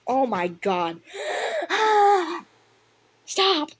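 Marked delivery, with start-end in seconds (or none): 1.0-2.4 sharp, high gasp of disbelief; shocked gasp. Real, not acted. Reader gasps in shock at the character's line. 3.3-3.7 high, loud cry of protest; outraged shout. Real, not acted. Reader yells at the cat to stop.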